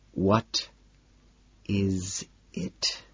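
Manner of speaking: soft, sharp hissed question. A narrator's reading. Patrick hissing at Lewis by the elevator, quiet hiss. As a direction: hiss it through the teeth, quiet. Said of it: "I finally hiss".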